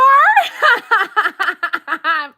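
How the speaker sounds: high, long burst of cackling laughter; real cackle. Real, not acted. Reader cracking up over the car relationship comment, high cackle.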